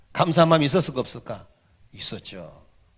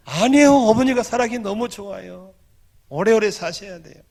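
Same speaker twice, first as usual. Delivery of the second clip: raised, pleading insistence to his mother; insisting loudly; 6 semitones higher, 7 dB louder than usual. Retold or imitated. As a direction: loudly reassure her she must live long.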